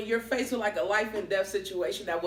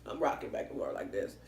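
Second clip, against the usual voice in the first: hushed; 12 semitones lower, 8 dB quieter than usual. Real, not acted. Acting out rocking.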